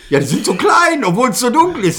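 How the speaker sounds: high, loud mock accusation; hammed-up police suspicion, pitched high; accusing officer. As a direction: imitate an agitated officer spotting evidence. Retold officer accuses him over pupils.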